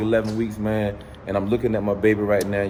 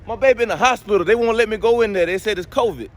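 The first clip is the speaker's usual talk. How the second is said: higher, louder hammed-up grief; mock lament; 10 semitones higher, 5 dB louder than usual. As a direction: overplay the heartbreak for laughs. Car owner mock-grieving car in shop.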